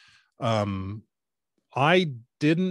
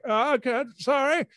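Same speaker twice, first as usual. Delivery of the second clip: high, mildly bewildered apology; confused farmer; 12 semitones higher than usual. Retold or imitated. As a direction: answer the wrong thing, puzzled. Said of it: "he's like".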